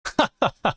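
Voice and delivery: short high burst of laughter. Real, not acted. Streamer reacting to Musiala's goal, short high laugh.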